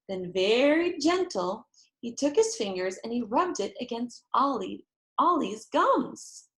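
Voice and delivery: ordinary delivery, nothing marked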